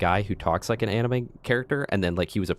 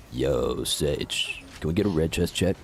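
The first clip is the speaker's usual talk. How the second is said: deep voice; 5 semitones lower than usual. Acted. Deep put-on voice to teammate.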